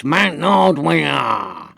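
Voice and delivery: long drawn-out cat-style catchphrase; slow, elongated mimicry of the cat; cat's catchphrase. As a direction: stretch both words out slowly, like a yowling cat. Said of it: "the way the cat says".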